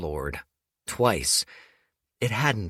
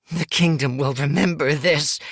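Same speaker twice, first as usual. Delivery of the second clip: higher, louder snarl of spite; snarled threat; 6 semitones higher, 6 dB louder than usual. Acted. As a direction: spit it out as a bitter vow. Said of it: "she snarled".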